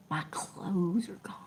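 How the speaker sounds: hushed real whisper. Real, not acted. Telling chat her clothes were taken, whispered. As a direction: breathe the words out without voice. Lamenting stolen belongings.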